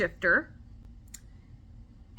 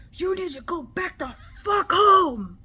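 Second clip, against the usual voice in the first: high, loud angry yell; retold fury at full pitch; enraged; 9 semitones higher, 7 dB louder than usual. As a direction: yell it like you're banishing someone. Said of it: "I got enraged, like, super enraged".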